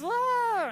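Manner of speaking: high mock wail of a crying toddler; imitated child wail. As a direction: squeal it like a panicking kid. Imitating kid's hysterical crying.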